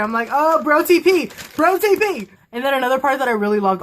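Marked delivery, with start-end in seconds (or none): 0.3-2.3 high, loud gleeful chant; excited fangirling. Retold or imitated. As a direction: cheer it like a thrilled fan. Her excited fangirl reaction, acted out.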